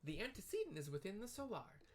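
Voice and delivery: very high, polite and eager; tiny helpful clerk, very high; sweet high Lalafell. Acted. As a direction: squeak out an apology and ask sweetly. Tataru directs the player.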